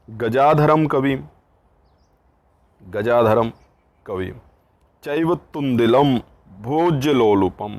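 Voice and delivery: loud, slow, measured recitation; recited Sanskrit verse. Acted. Teacher reciting the boy's teasing poem to the class. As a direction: declaim each word of the verse. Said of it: "बच्चे की कविता".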